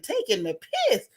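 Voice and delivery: "Taking the piss?" thrown up high in disbelief; incredulous echo. Retold or imitated. Laughing at the fraudster's odd phrase, incredulous high echo. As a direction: repeat his phrase like you can't believe it.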